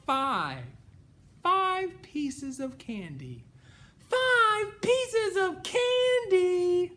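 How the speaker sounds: building to a high, loud, slow insistence; insistent. Acted. Mama P insisting.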